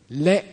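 very short 'Le'; shortest length. Shown as a demonstration. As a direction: cut the vowel of 'Le' short. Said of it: "three different lengths of it".

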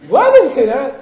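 loud, high indignant protest; with feeling. Shown as a demonstration. Teacher acting out a debater's retort with loud feeling. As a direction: protest at the top of your voice. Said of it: "say it with feeling".

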